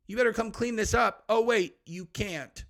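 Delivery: gloat, then pretend to weep; mock taunt.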